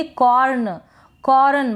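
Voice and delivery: कॉर्न overdone as kaw-run; कॉर्न with the R stretched into its own syllable; wrong full R. Shown as a demonstration. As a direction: give कॉर्न a fully voiced R. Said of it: "र का पूरा sound निकाल देंगे".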